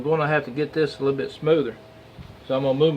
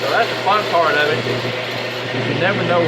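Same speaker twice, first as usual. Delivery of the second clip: loud and higher, over machinery; raised voice over noise; 4 semitones higher, 4 dB louder than usual. Real, not acted. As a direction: project over a running lathe. Talking over the running lathe.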